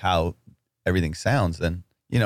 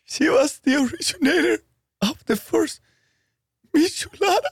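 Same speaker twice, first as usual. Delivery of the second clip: mock tearful. Continues fake-crying abuela story.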